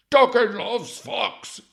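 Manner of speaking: the hammed-up dog goes over the top; dopey dog voice cranked up. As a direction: bellow doggy loves fox like an overexcited pet. Voicing the dog through psychic.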